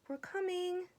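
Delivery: high called reply. A narrator's reading. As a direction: shout back up to the trees. Animals answer the bird.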